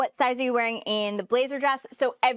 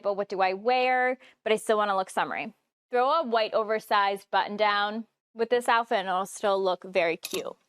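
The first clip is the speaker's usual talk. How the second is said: normal talking voice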